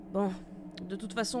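ordinary talk, nothing marked

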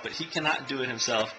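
usual speaking voice

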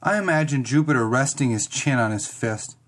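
ordinary delivery, nothing marked

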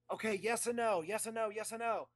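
put-on high, loud impatient old man; Max voice. Actor demanding an answer, high and insistent. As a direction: pitch way up and hammer it, impatient. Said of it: "do the Max voice for all this".

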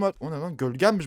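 ordinary delivery

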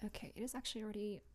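normal talking voice

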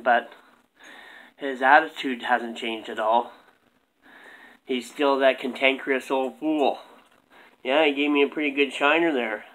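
low, soft gruff puppet voice; low ventriloquist dummy. Acted. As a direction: mumble low like a tired puppet.

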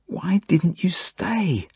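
high, long-drawn wail of dismay; moan. A narrator's reading. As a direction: moan it slowly, pitch climbing high. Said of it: "I moan".

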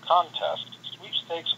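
ordinary delivery

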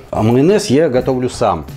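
normal talking voice

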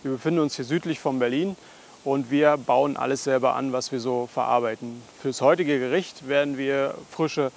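normal talking voice

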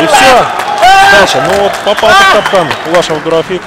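loud, high, excited play-by-play; wrestling commentator. Acted. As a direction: call the finish at full pitch. Live fight play-by-play.

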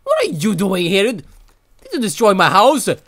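accusing Anita of wrecking the house; angry accusation. Real, not acted. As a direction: confront the intruder. Accusing a creature named Anita.

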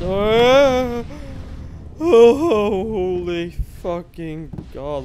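loud startled slow oh holy god; startled cry. Real, not acted. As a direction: jump in fright and drag it out. Startled by noise in game.

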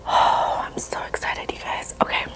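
breath out into an excited whisper; very soft, whispered anticipation; hushed excitement. Real, not acted. About to take first bite.

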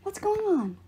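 high-pitched, urgent demand. A narrator's reading. Percy demands answers from Apollo, demanding. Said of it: "I demanded".

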